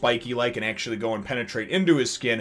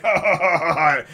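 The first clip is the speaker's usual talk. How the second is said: louder, higher taunting ha-ha; mocking villain laugh; 4 semitones higher, 6 dB louder than usual. Uvo scoffing at the trapped worm, mock laughter. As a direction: laugh off the threat like a smug brute. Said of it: "Uvo's just like".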